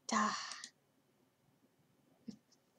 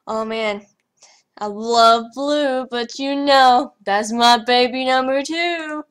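much louder, higher, slow gush; hyper; 4 semitones higher, 12 dB louder, slower than usual. Real, not acted. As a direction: gush over the blue item. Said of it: "I don't know why I'm so hyper".

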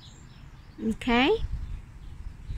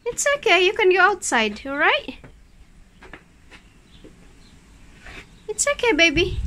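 higher, gentle pet talk; soothing a scared dog; 5 semitones higher than usual. Real, not acted. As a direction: reassure a frightened pet.

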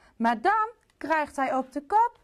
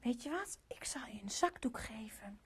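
soft comforting whisper; queen whispering comfort to the sad king; 13 dB quieter than usual. Acted. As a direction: whisper to soothe him.

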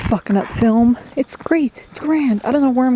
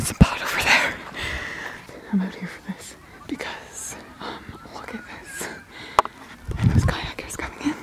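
whisper; 4 semitones higher, 9 dB quieter than usual. Real, not acted. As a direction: whisper as if not to disturb the scene. Whispering while filming kayakers.

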